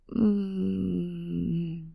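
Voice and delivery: long, drawn-out "mm"; contented hum. Acted. Feeling the warm sun hugging you, contented hum. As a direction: savour it with a sustained hum. Savouring the sun's hug.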